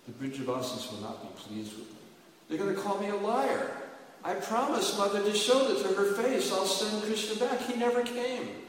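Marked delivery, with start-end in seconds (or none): 2.5-8.6 loud, high, pleading lament; lamenting Uddhava. Acted. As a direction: plead with Krishna in a raised, grieving voice. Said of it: "And Uddhava was crying".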